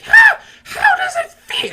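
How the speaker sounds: put-on loud, squeaky kid gloating; Harry Potter. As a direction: shriek the taunt extremely high, drawn out. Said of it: "he's like".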